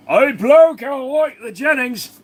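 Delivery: high-pitched, drawn-out Aussie bloke; exaggerated high Australian accent; put-on Australian accent. Put-on foreigner voice repeating the line.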